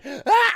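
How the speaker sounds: high, loud yelp of pain; pained scream. Retold or imitated. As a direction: cry out as if jabbed. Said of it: "I'm screaming my top".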